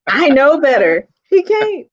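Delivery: real giggling, pitched high; high-pitched amused protest through laughter; laughing. Real, not acted. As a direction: crack up between the words. Laughing as child nearly pulls wig.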